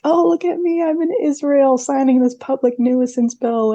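high, smug boastful politician voice; mock his self-congratulation; mocking imitation.

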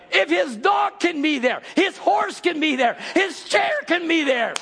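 high, shouted preaching; preaching at full force. Acted. Preacher rallying the congregation, shouting. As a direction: drive it home, shouting.

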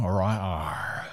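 soft West Country "arr"; low, quiet West Country burr; West Country accent. Pretend. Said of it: "talking on a West Country accent now".

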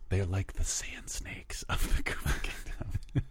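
hushed conspiratorial whisper. Real, not acted. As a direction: whisper it like a secret. Whispered joke.

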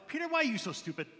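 high, quick mock scolding; mock exasperation. Reader scolding disciple Peter for foolishness, comic exasperation. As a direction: chide him in playful disbelief.

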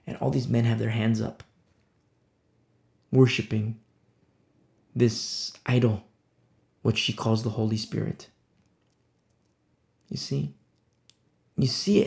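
ordinary talk, nothing marked

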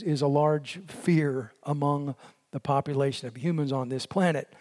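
ordinary talk, nothing marked

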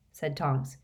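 ordinary delivery

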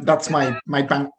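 usual speaking voice

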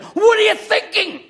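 high, loud mock scream; retold scream. Brother at knifepoint screams at the preacher. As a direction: yell it in terrified shock. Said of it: "and he screamed at me".

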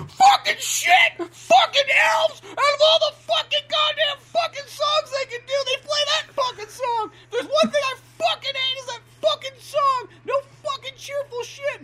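high, drawn-out strained shouting as if from another room; hammed-up cursing tantrum; imitating a ranting Santa. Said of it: "probably in the next room going".

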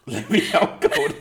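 laughing. Real, not acted. Host repeats his friend's joke slogan, laughing. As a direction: laugh through the words.

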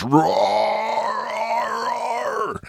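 high screeching roar, hammed up; mock shark roar. Podcaster mocking the shark's silly roar in Jaws Revenge, hammed-up screech. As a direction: screech the shark's roar like a cheap movie monster. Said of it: "the shark Bruce screams and goes".

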